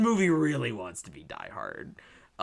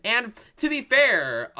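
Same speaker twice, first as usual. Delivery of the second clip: usual speaking voice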